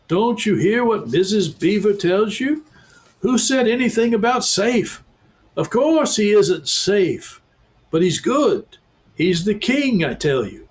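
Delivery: high, firm beaver voice; stern talking beaver. A narrator's reading. Beaver insisting Aslan is the lion, high and firm. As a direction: set the children straight.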